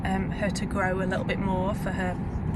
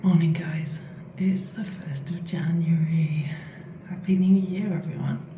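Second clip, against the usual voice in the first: whispering while hiding; 2 semitones lower than usual. Real, not acted. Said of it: "I'm whispering because baby's still asleep".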